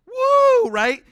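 a high, loud whoop of delight; excited whoop. Real, not acted. Preacher whooping at the I am line, loud and high. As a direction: whoop like the point just landed. Preacher exclaiming over scripture.

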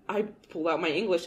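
ordinary talk

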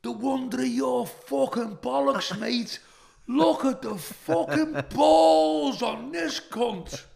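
high, loud, slow mocking heckle yelled at the stage; heckler shouting. Heckler in the pub crowd jeering [name], loud high shout. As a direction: bellow the abuse like a drunk heckler in the crowd. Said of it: "a fella shouted".

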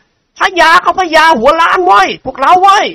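rally the crowd with a taunt; jeering shout. A narrator's reading. Student rallying classmates to mock him, high jeering yell. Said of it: "ร้องตะโกนขึ้นว่า".

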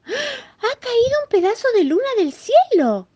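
gasp, then very high, loud mouse voice; amazed exclamation. Acted. Little mouse finds a piece of moon fallen from the sky, amazed exclamation. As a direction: squeal in astonishment as a tiny mouse. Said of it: "exclamó".

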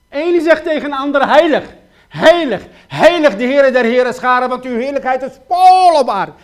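loud, urgent pleading sermon voice; preacher crying out his questions to the congregation, loud and pleading; full-force preaching. Acted. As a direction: hammer each question home from the pulpit.

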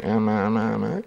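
imitando el tic de un niño, ma repetido; tic vocal imitado. Shown as a demonstration. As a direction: repite ma como un tic nervioso. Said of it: "un niño que tiene el tic que hace así". (imita el tic del niño con los labios)

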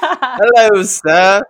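high, loud hammed-up British greeting; mock British accent. Co-host jokingly greeting the coach as a lord, mock British accent. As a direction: put on a big, playful British accent. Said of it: "you had a good one a second ago".

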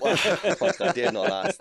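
high, loud words through a real laugh. Real, not acted. Guest cracking up at the co-host's queue joke, laughing.

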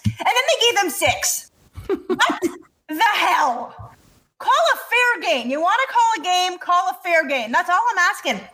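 high, building hockey rant; heated rant. Real, not acted. Fan ranting about the refs to her co-host, heated and high. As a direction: vent hard about the refs. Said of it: "And scene".

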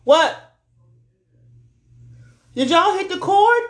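high, loud startled outburst; surprised. Real, not acted. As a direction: react to a sudden bang. Startled by an interruption.

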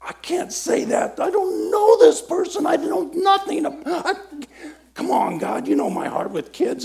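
hammed-up high-pitched fretting; panicky inner voice. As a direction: squeak it out in comic panic. Panicking inner voice during exercise.

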